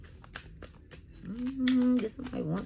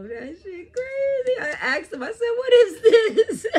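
real amazed laughing cry; amazed laughter; 10 dB louder than usual. Real, not acted. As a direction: squeal in disbelief.

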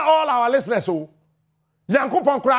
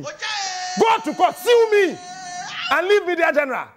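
defiant shout; 6 semitones higher than usual. Real, not acted. Radio host yelling at the commission, defiant shout. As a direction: go to court, sue me, belted out high.

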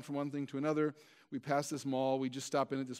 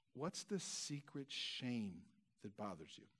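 much softer and very slow, almost secretive; hushed question; 5 semitones lower, 14 dB quieter, slower than usual. Acted. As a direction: lean in and drop the voice to a hush. Preacher drops to confiding hush.

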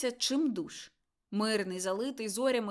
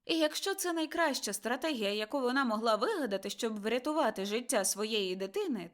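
usual speaking voice

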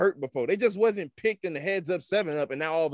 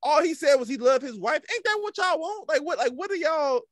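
incredulous outburst; 9 semitones higher than usual. Real, not acted. Indignant at critics of Russell Wilson.

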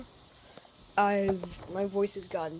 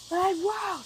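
loud excited question; 12 semitones higher than usual. Shown as a demonstration. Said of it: "my voice got deeper".

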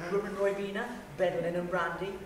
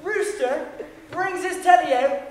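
loud storytelling; 8 semitones higher, 11 dB louder than usual. Real, not acted. Telling wild night loudly.

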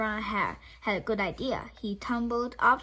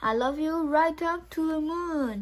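higher, dreamy little hare; 7 semitones higher, 4 dB louder than usual. Acted. Sleepy little hare at bedtime, higher and dreamy.

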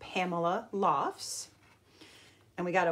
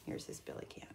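whisper; 16 dB quieter, faster than usual. Real, not acted. Teacher pointing at the picture, whispering. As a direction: whisper it like a secret.

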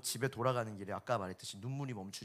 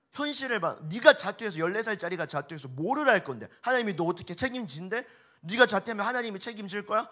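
building from a hard scold to a loud, high shouting tirade; furious teacher; 8 semitones higher, 9 dB louder than usual. Retold or imitated. Homeroom teacher raging at a boy's dropout form, shouting angrily. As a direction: tear into the boy, rising to a yell. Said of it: "분노 조절 장애인가 봐요".

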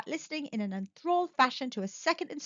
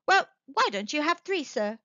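pert, higher-pitched quip; clever; 6 semitones higher, 3 dB louder than usual. A narrator's reading. Son challenging the soldier, cleverly. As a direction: toss it out as a smart challenge.

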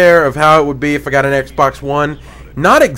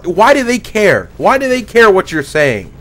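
ordinary talk

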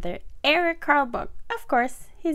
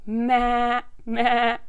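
louder nasal-sound "maa" of a goat; goat bleat; 4 semitones higher, 5 dB louder than usual. A narrator's reading. Storyteller as the goat calling to the spider, loud bleating. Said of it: "said the goat".

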